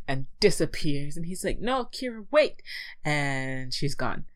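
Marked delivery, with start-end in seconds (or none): 1.6-2.6 calling out desperately. Retold or imitated. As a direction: call after someone who is slipping away. Calls after vanishing lover.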